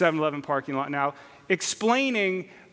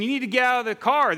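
raised and quick, higher voice; mock crowd yell; 6 semitones higher, 4 dB louder, faster than usual. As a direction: shout it like the crowd at the fence. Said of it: "They're yelling at the guy in the truck".

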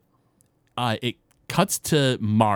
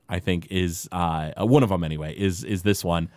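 ordinary talk

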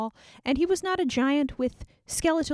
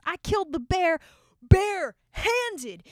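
slow, higher, drawn-out "bare-handed"; emphatic boast; 8 semitones higher, 3 dB louder than usual. Retold or imitated. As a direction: lean hard into "bare-handed". David boasting to Saul.

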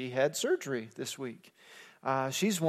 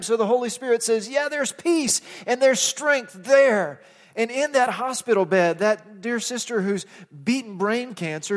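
raised, high-pitched exhortation; fervent preaching; 6 semitones higher, 5 dB louder than usual. Real, not acted. Pastor telling friends how God speaks, raised and fervent. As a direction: press it on the congregation with rising fervour, then ease slightly.

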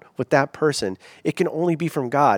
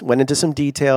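usual speaking voice

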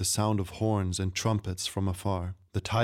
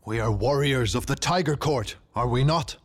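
raised, challenging royal voice; shouted rebuke; 4 semitones higher, 3 dB louder than usual. A narrator's reading. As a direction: rebuke the warriors as their outraged king. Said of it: "he shouted".

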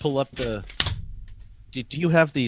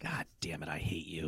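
mock-bitter whisper; 10 semitones lower, 16 dB quieter than usual. Real, not acted. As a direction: breathe it like a grudge. Losing the guess.

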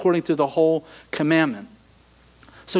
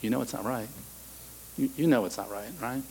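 much softer, lower, tender nudge; gentle quiet voice; 4 semitones lower, 12 dB quieter, faster than usual. Pretend. Preacher voices God gently correcting you, soft and low. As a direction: speak it gently, almost a whisper. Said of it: "He's like".

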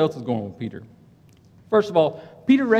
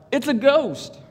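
fearful cry; 8 semitones higher, slower than usual. A narrator's reading. Terrified disciples spot a figure on the water, mild fearful cry. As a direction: read it as a frightened outcry, volume held back. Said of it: "they cried out".

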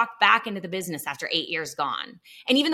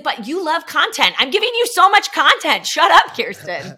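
teasing God; 8 semitones higher, 8 dB louder than usual. Retold or imitated. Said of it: "And he's like".

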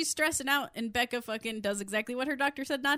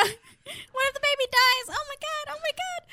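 mock wailing panic; 14 semitones higher, 4 dB louder than usual. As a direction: wail it like a fretting monster.

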